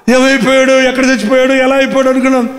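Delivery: normal talking voice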